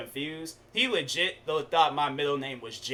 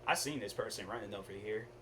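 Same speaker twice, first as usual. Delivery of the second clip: ordinary talk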